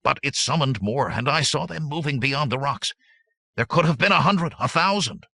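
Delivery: high, climbing, urgent voice; alarmed insistence. A narrator's reading. Aberius insists on his alarming tale.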